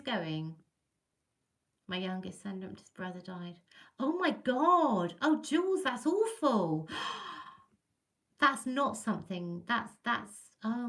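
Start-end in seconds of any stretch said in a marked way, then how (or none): 4.0-7.0 drawn-out, higher, dismayed reaction; shocked sympathy. Real, not acted. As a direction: gasp out your horror for a friend, slowly. Reacts to news of a death.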